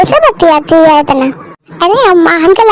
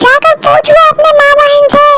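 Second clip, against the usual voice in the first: snapping wife; 6 semitones higher than usual. Acted. Wife snaps back while leaving.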